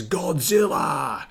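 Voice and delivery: hammed-up monster-movie title shout; mock-dramatic shout. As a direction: call out "Godzilla" like a film trailer. Hammy announcement of comic title.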